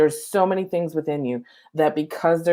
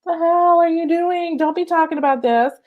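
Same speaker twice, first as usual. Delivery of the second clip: inner critic screaming; 10 semitones higher, 6 dB louder than usual. Retold or imitated. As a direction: yell it like a panicked voice in her head. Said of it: "like, screaming".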